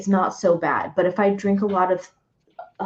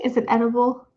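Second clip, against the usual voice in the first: normal talking voice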